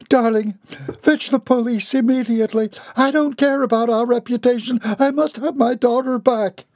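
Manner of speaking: very high, urgent pleading; frantic distress. A narrator's reading. Wife sending husband for police, frantic. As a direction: beg him in a high-pitched panic.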